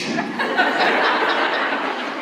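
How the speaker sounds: high unvoiced giggle at the miss; high laugh. Laughing at her brother after the missed kick.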